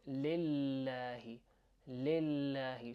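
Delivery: lillahi slow, L held long. Shown as a demonstration. Teacher showing learners the doubled L in lillahi, drawn out. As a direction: hold onto the L in lillahi. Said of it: "hold onto the L sound".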